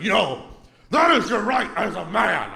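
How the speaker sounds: worked-up guy confronting a comedian; half-strength imitation of an irate man; mock angry yell. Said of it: "in my face screaming".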